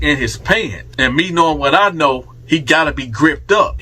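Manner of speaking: ordinary talk, nothing marked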